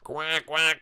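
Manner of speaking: playful quacks falling in pitch; duck quacking. Pretend. Trader showing the mallard marching along, playful quacking. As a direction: quack like a mallard opening its bill. It's quacking its mouth open.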